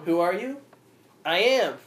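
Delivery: "I am" punched loud and high; dramatic emphasis on "I am"; stress on I AM. Shown as a demonstration. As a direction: lean hard on "I am" as God's name. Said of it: "emphasis on the "I am" part".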